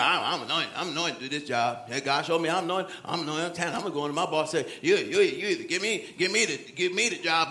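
low, muted mock boasting; self-important employee. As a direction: mimic someone puffed up about their calling. Mimicking self-appointed, boastful employee.

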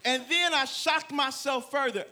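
ordinary delivery

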